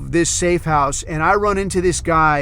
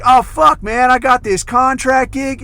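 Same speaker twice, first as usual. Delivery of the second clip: high, loud retold enthusiasm; excited bragging; 8 semitones higher, 5 dB louder than usual. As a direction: gush like a buddy showing off. Acts out friend bragging about gig.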